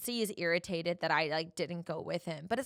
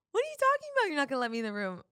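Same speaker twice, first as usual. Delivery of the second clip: much higher, loud, stunned; shocked disbelief; 8 semitones higher, 5 dB louder, much faster than usual. Retold or imitated. Girlfriend locked out, asking boyfriend through door, shocked disbelief. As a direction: hear you're locked out and can't believe it.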